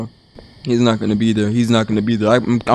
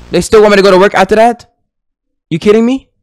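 fast, high, rising disbelief; quick, raised-pitch incredulity; incredulous question; 4 semitones higher, 5 dB louder, faster than usual. Real, not acted. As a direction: fire it off as an outraged question. Disbelief at game's next task.

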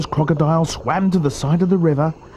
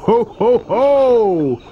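loud, high crocodile "ho ho"; big hammed-up ho-ho-ho; crying out; 10 semitones higher, 5 dB louder, much slower than usual. A narrator's reading. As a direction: boom out a mocking story-villain laugh. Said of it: "cried the enormous crocodile".

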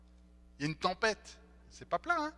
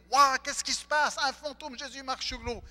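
high, loud panicked exclamation; scared disciples shouting at the sight on the water; frightened cry; 10 semitones higher, 10 dB louder than usual. Retold or imitated. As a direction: cry out in fear at a ghost. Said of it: "Ils ont peur".

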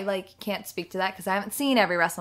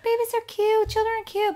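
high gushing voice; 13 semitones higher than usual. Retold or imitated. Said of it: "people are always like".

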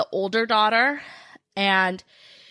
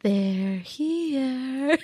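put-on eerie, drawn-out little-girl line; sing-song horror-film child; creepy little girl; 3 semitones higher than usual. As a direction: stretch it out like a spooky movie child. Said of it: "Do it again".

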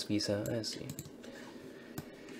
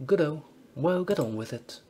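put-on higher, chummy British publican accent; British pub landlord accent; 5 semitones higher than usual. Dave Pleasure briefing his new bouncer in British accent. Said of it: "I should stop doing the accents".

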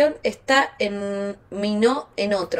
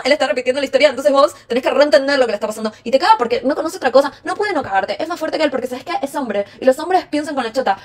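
foolish excusing friend; 2 semitones higher than usual. Acted. Friend urging you to forgive a cheater, foolish excusing friend. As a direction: rattle off indulgent excuses for him. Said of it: "sería una pelotuda acá diciéndote".

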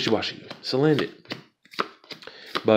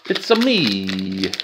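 Mario voice; much slower than usual. Pretend. Mario catchphrase impression.

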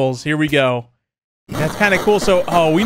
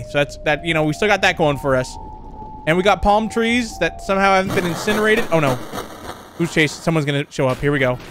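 ordinary talk

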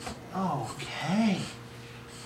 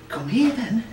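normal talking voice

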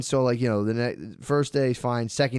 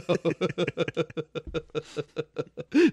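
long giggling fit; sustained helpless laugh; real laughter; 3 semitones higher than usual. Real, not acted. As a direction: crack up at the absurd bit. Laughing at own bit.

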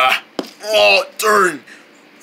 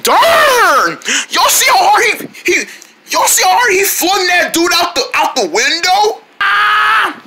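shocked shout; 10 semitones higher than usual. Acted. Fish yells as his friend is flung out the window. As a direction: shout it as he goes flying out.

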